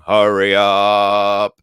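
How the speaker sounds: slow, loud mock nagging; hammed-up hurry-up call; imitating Blackboard. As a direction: drag it out like an impatient co-host. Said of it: "Blackboard's going".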